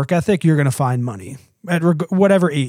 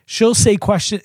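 ordinary talk, nothing marked

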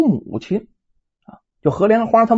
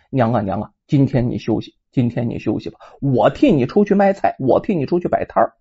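comforting mother; 8 semitones lower than usual. A narrator's reading. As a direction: gently reassure a mother in bed. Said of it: "赶忙安慰母亲".

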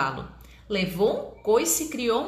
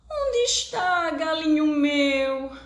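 tearful hen; 6 semitones higher, slower than usual. Acted. Hen crying for rooster.

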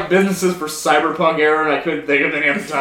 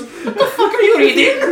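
very high, laughing disbelief; squealed appalled demand; mock horrified dad; 4 dB louder than usual. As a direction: shriek the dad's question in comic horror.

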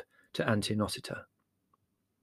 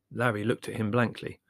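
ordinary delivery, nothing marked

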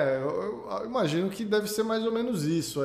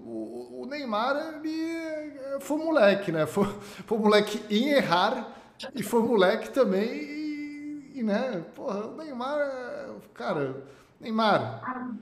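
mock high voice; 6 semitones higher than usual. Mocking high voice at Neymar.

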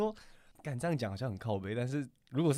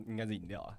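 throat still catching from the drink; choking on a drink; 9 dB quieter than usual. Real, not acted. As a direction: talk through a lingering cough. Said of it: "喝饮料呛到还是怎样".

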